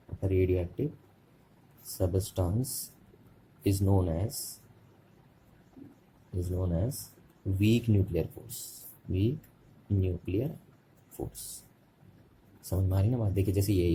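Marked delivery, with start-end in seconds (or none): 0.1-11.4 slow dictation, word by word with long pauses; measured teacher's dictation. Shown as a demonstration. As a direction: dictate for note-takers in small separate chunks. Dictates a definition for writing.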